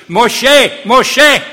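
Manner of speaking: high, loud divine call across the distance; God shouting to Moses from the burning bush; booming shout. Acted. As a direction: call his name like thunder from the bush. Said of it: "a word shouts out to him from the burning bush".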